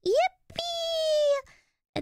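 very high squealed cheer; gleeful cheer. Real, not acted. Streamer thanking a visitor for stopping by, high gleeful squeal.

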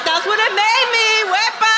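high, excited Puerto Rican cheer; rowdy proud whoop. Acted. Comic claiming she's a fine Puerto Rican, rowdy whoop. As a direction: crow it high, stretching out the words.

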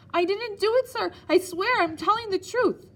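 hysterical plea. Acted. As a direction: beg in panic before the cane. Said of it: "my voice slightly hysterical now".

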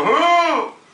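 put-on loud high old man's hail; calling out. Grandpa calls to the grandpa opposite, loud hail. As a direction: holler it over the street. Grandpa hailing his friend.

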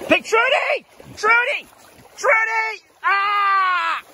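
high, urgent shouts after the animal; urgent calling. Real, not acted. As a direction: call out in real alarm. Chasing the escaping animal.

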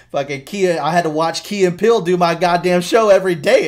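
loud, high, fed-up outburst; indignant rant. Retold or imitated. Comedian griping about the copycat show, loud and angry. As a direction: vent the grudge at full volume. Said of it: "He's like".

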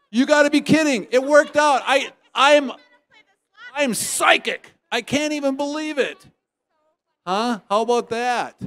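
can't believe his luck, let it burst out; delighted shout of disbelief at winning; excited disbelief. Real, not acted. Host's own name drawn as winner.

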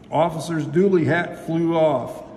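usual speaking voice

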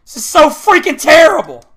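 fed-up gamer outburst; real exasperation. Real, not acted. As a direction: wail about how awful it is. Raging at a bad game.

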